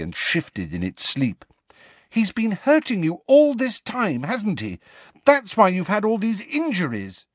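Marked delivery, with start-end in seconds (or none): 2.1-7.2 high-pitched, agitated reproach; high, indignant distress; upset accusation. A narrator's reading. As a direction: accuse him in a shrill rush of dismay. Shrill Hermione accuses Hagrid.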